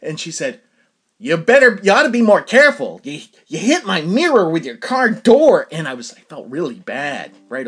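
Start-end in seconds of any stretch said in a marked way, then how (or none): 1.2-5.7 mock scolding yell, louder; a scolding old woman, voiced loud; old woman yelling over a bumped mirror, louder; retold yelling. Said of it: "she's yelling at me".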